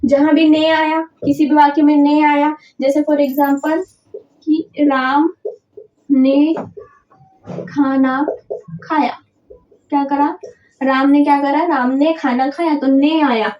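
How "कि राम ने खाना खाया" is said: slow dictation. Shown as a demonstration. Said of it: "teacher dictates example slowly".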